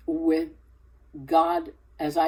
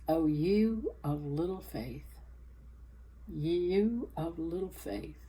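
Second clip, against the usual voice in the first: soft, slow, quiet chiding; gentle rebuke; 9 dB quieter than usual. Retold or imitated. Jesus quietly chiding his panicking disciples, soft and slow. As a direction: hush them like a patient teacher.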